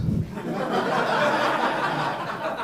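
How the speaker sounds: high breathless wheeze of laughter; high wheezing laugh. Real, not acted. Laughing at his own joke.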